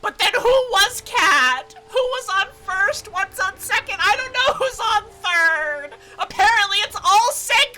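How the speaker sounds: high, loud, slowed exclamation; frantic confusion. Real, not acted. Gamer ranting about identities, frantic confusion. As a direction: rant in comic bewilderment.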